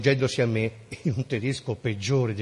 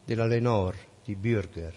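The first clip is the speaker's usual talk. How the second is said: usual speaking voice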